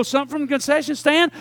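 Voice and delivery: ordinary talk, nothing marked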